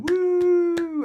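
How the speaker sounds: high, loud whooping cheer; mock cheering passengers. Passengers cheering the landing, done as a high loud whoop. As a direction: imitate the whole plane whooping at landing. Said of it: "everyone's going".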